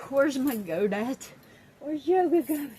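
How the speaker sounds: loud, high call for the goat. Real, not acted. Calling across the room for her goat, loud and high.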